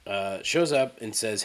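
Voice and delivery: ordinary delivery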